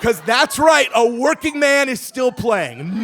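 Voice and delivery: high, loud, slow shouted punchline. Real, not acted. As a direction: shout out the absurd payoff. Punchline about the movie.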